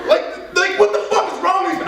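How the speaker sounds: high, incredulous comic protest; exasperated mock rant. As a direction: protest as if you can't believe him.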